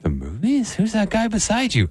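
high-pitched, playful curious caller; mock call partner. Friend on a pretend movie-theater video call, mock curious. Plays the other end of call.

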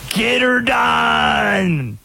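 slow, high Southern holler; drawn-out yelled Southern catchphrase; Larry the Cable Guy. Retold or imitated. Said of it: "Do Europeans know Larry the Cable Guy?".

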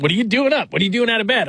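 high, mock scolding parent voice. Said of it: "she's not my child".

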